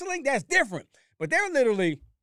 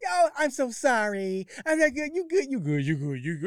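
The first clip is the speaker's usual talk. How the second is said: mocking her impression; 4 semitones higher than usual. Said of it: "You went from".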